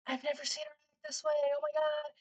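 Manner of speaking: high shocked gasping voice for the onlookers. Retold or imitated. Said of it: "looked at me with such shocking faces".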